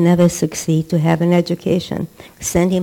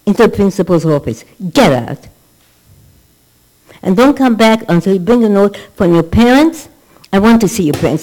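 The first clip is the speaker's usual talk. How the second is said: loud scolding teacher, fast at first; angry teacher; 5 dB louder, faster than usual. Retold or imitated. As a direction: bark the kid out of class. Angry teacher throws child out.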